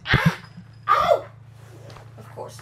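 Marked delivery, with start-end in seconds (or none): 0.1-1.3 short high yelps of pain; sudden high "ow"; startled yelps. Real, not acted. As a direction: yelp sharply, twice. Startled yelps while fixing something.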